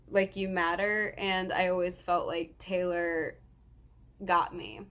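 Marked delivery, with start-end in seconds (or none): none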